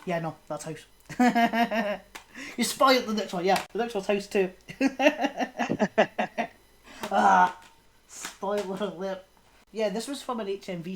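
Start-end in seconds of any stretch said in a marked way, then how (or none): 1.1-2.5 real chuckling burst; amused laugh. Real, not acted. Host caught out by a friend, amused laugh. Teased about spoiling the next film. 4.5-6.5 long real chuckle; amused laugh. Real, not acted. Host laughing at a spoiled sequel, amused laugh.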